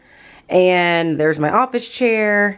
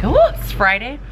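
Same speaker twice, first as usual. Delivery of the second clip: higher, mock-delighted "Oh"; pretend Friday cheer; 5 semitones higher than usual. Moody teacher imagining a cheerful wake-up, pretend delight. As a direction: play the happy waking moment lightly. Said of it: "you'd think I'd wake up like".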